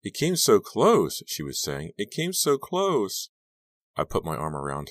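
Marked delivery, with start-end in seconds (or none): 0.0-1.2 high, slow tearful voice; slow, high, crying words. A narrator's reading. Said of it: "Dawn was crying". 2.0-3.3 high, slow tearful voice; slow, high, teary repeat. A narrator's reading. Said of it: "Dawn was crying".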